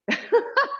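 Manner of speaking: high little burst of laughter; real laugh. Real, not acted. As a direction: laugh at how bad it feels. Laughs at the crappy feeling.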